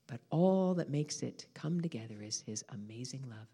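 low, soft, lingering delivery; tender awe. Real, not acted. Preacher speaks of God's love to congregation, tender awe. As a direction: hush it into quiet wonder.

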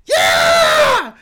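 high shouted cheering name; excited cheer. Acted. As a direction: whoop like a fan going wild. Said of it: "is like".

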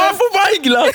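very high-pitched mock tantrum shriek; screaming child. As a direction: imitate a kid shrieking a refusal. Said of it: "Bara skriker".

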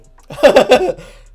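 high wheezing laugh; can't stop laughing; loud laughter. Real, not acted. Laughing fit continues.

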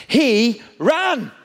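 preacher's mounting "He ran"; building excitement. Real, not acted. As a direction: hammer home the shock, rising each time. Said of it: "Here's the shock".

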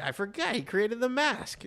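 high, excited retelling of a surprise; half-mock shock at remembering; surprised realization. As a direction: pitch it up like it just hit you. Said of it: "I was like".

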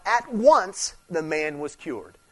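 "at once the man was cured" drawn out very slowly; slow emphatic stress. Shown as a demonstration. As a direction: stretch "at once" slowly and deliberately. Stressing the miracle phrase.

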